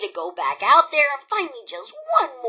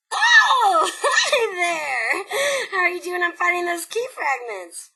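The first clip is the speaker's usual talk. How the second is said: startled high squeal into sugary greeting; jump, then cover with sweetness; caught off guard; 6 semitones higher, slower than usual. Acted. Caught grumbling to herself, she squeals a fake-cheery hello.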